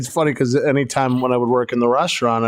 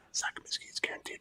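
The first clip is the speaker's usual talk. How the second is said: very soft, whispered mutter; barely voiced whisper; whispered aside; 21 dB quieter, faster than usual. Real, not acted. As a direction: slip it in under your breath. Muttered aside about park's name.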